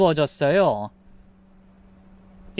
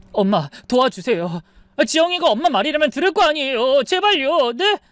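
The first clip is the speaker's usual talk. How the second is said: tearful pleading; 6 semitones higher than usual. Acted. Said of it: "아들이 울면서 저한테 매달렸어요".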